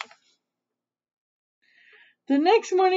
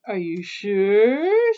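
slow, rising question on "sure"; doubtful question; 3 dB louder, much slower than usual. A narrator's reading. Mother witch doubts Little Witch's answer, slow rising "sure". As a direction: stretch "sure" upward in doubt.

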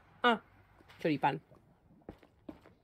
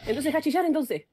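louder and higher, fed up; exasperated shout; 6 semitones higher, 5 dB louder than usual. Real, not acted. Streamer scolding the artist for whining, loud and exasperated.

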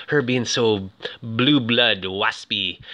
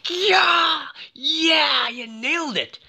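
hammed-up fan cheering his buddy on; mock excited buddy; slower than usual. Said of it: "he's just, like, so excited".

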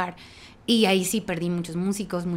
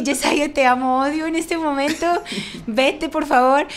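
exasperated plea; 6 semitones higher, 5 dB louder than usual. Retold or imitated. Retelling to her husband how she told him to go, mock-exasperated. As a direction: push him away half-laughing. Quoting herself hurt and angry.

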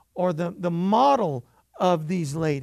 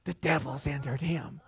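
hushed, low mock warning; mock church lady; 5 semitones lower, 9 dB quieter than usual. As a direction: spook it like a scandalized elder.

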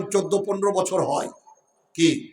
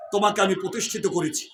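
normal talking voice

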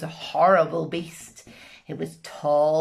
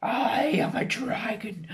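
menacing whispered dragon; 4 semitones higher than usual. Acted. Dragon to the witch, whispered menace. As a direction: breathe it like a lurking beast. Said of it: "dragon threatens witch".

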